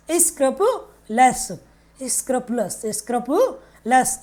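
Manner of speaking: स्क्रपुलस stretched slowly, every syllable drawn out; slow drill pronunciation. Shown as a demonstration. Teacher modelling स्क्रपुलस for students, drawn out slowly. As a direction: lay out स्क्रपुलस slowly so students can copy it. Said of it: "teacher repeats vocabulary word".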